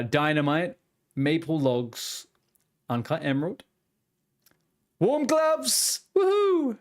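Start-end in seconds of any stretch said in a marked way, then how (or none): 5.0-6.8 high delighted whoop; excited cheer. Real, not acted. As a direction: yell with joy at the prize. Rare drop from box.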